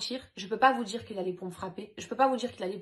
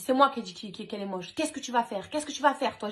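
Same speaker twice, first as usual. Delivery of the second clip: louder, higher, aggressive challenge; acting out an angry man squaring up to her; retold confrontation; 3 semitones higher, 7 dB louder, faster than usual. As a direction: throw it out as a heated challenge.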